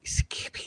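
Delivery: imitated whisper, soft and voiceless; a hushed, wordless whispered noise; Libby. Streamer showing how the whispering supposedly went, whispered. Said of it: "so Libby's whispering".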